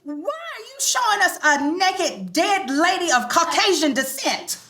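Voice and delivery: high, loud disbelieving demand; outraged shout. Acted. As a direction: yell it at her, scandalised. Outraged at the photo.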